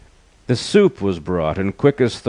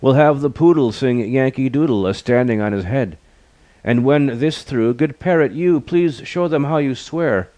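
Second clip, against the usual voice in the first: high-pitched hostess voice; raised, lilting character voice; 6 semitones higher than usual. A narrator's reading. Hostess entertaining her waiting guests, high hostess voice. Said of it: "the hostess said".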